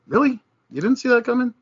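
high, disbelieving "Really?" to the fans; incredulous mockery. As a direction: sneer at their surprise. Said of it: "I'm sitting there going".

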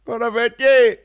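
very high, loud mock Belfast accent; joke Belfast greeting. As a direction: shout it as a jokey Belfast caricature. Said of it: "as a joke".

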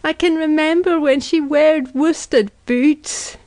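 high, slow mournful cry; drawn-out lament, pitched high. A narrator's reading. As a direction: wail it in grief. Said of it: "she cried".

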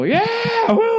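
very high-pitched mock crowd whoop; screaming concert fan. Drunk fan cutting off the singer mid-sentence, screaming whoop. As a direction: imitate a wasted fan cheering far too early. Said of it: "people are already screaming".

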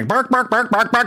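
hammed-up yelling in a made-up language; mock foreign shouting. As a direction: bark nonsense like a foreign curler. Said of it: "If it's in another language".

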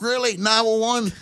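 incredulous wife imitation. Husband mimicking his wife doubting the 911 call, very high. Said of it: "She's like".

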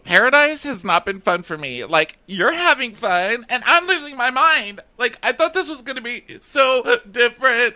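high-pitched, worked-up imitation of her; mock tearful complaint. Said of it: "she's crying to us".

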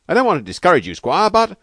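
high, loud and hesitant at the end; calling out. Acted. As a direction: call a warning through the door. Calling through the bathroom door.

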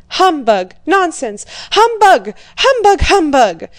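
high, loud repeated outbursts; an old prince crying out against the marriage talk; angry cries. A narrator's reading. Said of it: "cried Prince Bolkónski".

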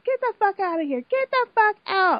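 high, mock furious yell at a housemate; irate yelling. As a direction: throw her out of the room, shrill with anger. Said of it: "Caitlin gets irate".

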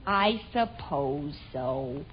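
low, slow, soft resignation; reluctant surrender. Acted. Daughter giving in to mother about book, glum. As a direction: give in glumly, dragging the words.

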